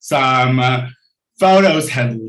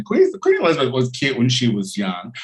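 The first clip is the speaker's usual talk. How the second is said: ordinary talk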